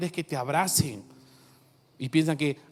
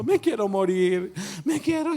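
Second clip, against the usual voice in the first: anguished cry; 4 semitones higher, 4 dB louder than usual. Retold or imitated. Preacher voicing Elijah's despair, crying out. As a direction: voice climbing high in despair.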